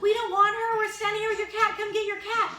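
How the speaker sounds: high, loud indignant protest; exasperated retort. Retold or imitated. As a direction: retell an outraged reply at full tilt. Lady retorts to the cat's owner.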